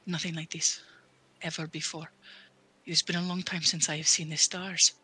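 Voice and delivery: put-on subdued wonder in a foreign accent; quietly awed accented paladin. Paladin seeing stars after a long time, quietly awed. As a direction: paladin speaking in measured, pensive phrases. Godfried marvels at the light.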